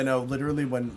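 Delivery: normal talking voice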